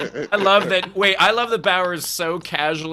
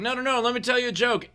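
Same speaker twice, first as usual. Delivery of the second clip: much higher, eager insistence; imitating an insistent driver; 11 semitones higher than usual. Driver insists on telling comedian a joke, mock-eager. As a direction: push the offer in a high mock voice.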